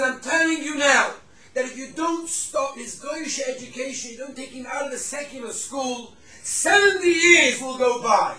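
loud, high-pitched retold rebuke; building to a slow, shouted prophecy; stern warning. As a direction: imitate a rabbi forcefully warning a father. Rabbi's warning to the father, acted.

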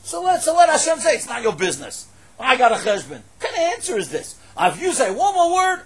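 loud, high indignant preaching; heated exclamation. Real, not acted. Preacher protesting to the congregation, loud and indignant. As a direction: fire it out in disbelief.

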